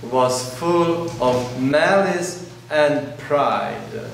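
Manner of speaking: usual speaking voice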